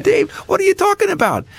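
stunned disbelief. Retold or imitated. Father startled by son's tip idea, high incredulous question. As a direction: play it half-falling-off-the-chair amazed. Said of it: "I said".

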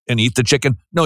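ordinary talk

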